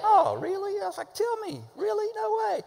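high-pitched, wide-eyed wonder; amazed disbelief. Acted. Preacher acts out casual no way, amazed. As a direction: react like hearing juicy news, way up high. Acting out amazed lowercase reaction.

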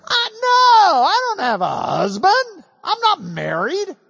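"no" blurted high and loud, then "I don't have a husband"; the woman insisting, "no" hit hard up front; stress on no. Retold or imitated. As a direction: throw out "no" first, loud and high, like a defensive woman. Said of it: "when she says that first word is no".

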